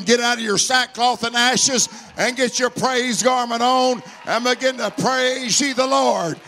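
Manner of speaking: loud, driving preacher's call; full-force preaching. Acted. Preacher exhorting the church to praise, loud and driving.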